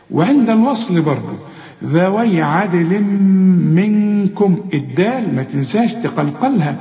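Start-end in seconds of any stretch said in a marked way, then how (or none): 1.8-4.6 slow ذوي عدل منكم, humming into the م and on the ن; double ghunna. Shown as a demonstration. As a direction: linger nasally at both joins in عدل منكم. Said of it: "عندك اتنين غنة".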